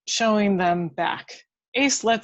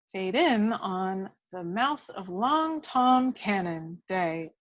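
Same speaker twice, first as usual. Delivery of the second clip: ordinary talk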